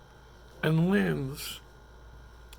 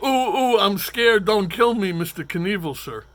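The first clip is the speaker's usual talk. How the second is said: mocking fear; 4 semitones higher, 9 dB louder than usual. Mocking the brawler.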